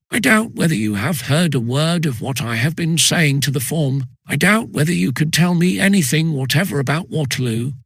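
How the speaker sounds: plain reading voice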